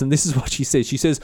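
plain reading voice

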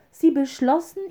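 ordinary delivery

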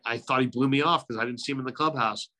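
ordinary talk, nothing marked

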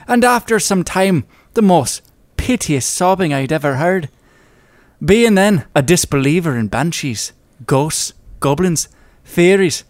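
put-on theatrical Irish storyteller accent; hammed-up Irish narration. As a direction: read the old tale in a lilting Irish accent, pausing between phrases. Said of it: "let me just get my, uh, accent here".

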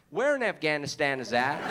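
put-on clueless American accent; mimicked American accent. American mishearing his home town, mock American accent. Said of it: "They're always like".

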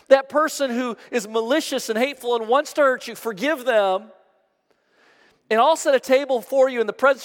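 high, loud assuring Jesus, building; Jesus voiced in full preaching. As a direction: pour out the psalm as a promise. Jesus speaks in the prayer scene.

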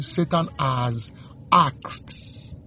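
drawn out, rising high on sift; ominous slow read; slow dramatic reading. A narrator's reading. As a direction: stretch each word for weight.

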